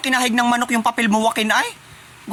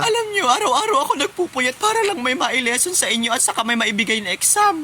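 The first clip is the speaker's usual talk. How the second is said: ugly crying; 3 semitones higher, 6 dB quieter than usual. Acted. Teacher pleads with the mocking class, ugly crying. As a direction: sob through a hammed-up complaint. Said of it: "Ang pangit umiyak".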